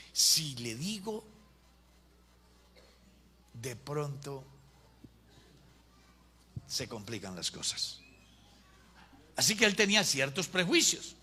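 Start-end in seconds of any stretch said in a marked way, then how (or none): 0.1-8.0 slow, low, hushed musing with long pauses; thinking to himself. Acted. Pastor weighing whether to confront his rich member, thinking to himself. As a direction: think aloud softly, sinking lower. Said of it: "el pastor se puso a pensar".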